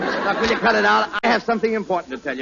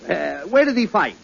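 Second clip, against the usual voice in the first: usual speaking voice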